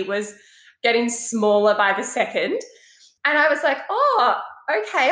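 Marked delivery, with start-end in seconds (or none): 3.9-5.1 high, taken-aback oh okay; stunned oh okay. Retold or imitated. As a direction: caught off guard, voice jumps up. Retold shocked reply.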